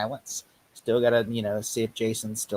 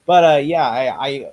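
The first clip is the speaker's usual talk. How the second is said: usual speaking voice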